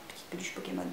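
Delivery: breathy hushed whisper; dreamy whispered Pokémon plush. Pretend. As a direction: whisper it like a secret wish. Dreamy whisper about plush toys.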